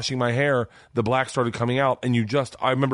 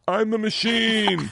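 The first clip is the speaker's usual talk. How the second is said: put-on proud drunken boast; 9 semitones higher, slower than usual. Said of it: "all I knew how to say was".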